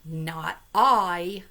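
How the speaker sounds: the dog says no, loud; dog's refusal. Acted. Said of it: "said the dog".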